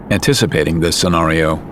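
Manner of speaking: ordinary talk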